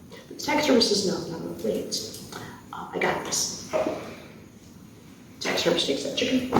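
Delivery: ordinary delivery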